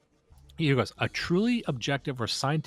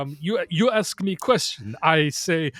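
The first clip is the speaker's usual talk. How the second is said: higher, louder mock Eastern European accent, halting; Borat Zizek voice; 6 semitones higher, 5 dB louder than usual. Host mocking the writer's stuttering podcast interviews, loud Eastern European accent. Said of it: "Borat Zizek voice".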